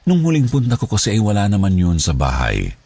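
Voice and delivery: plain reading voice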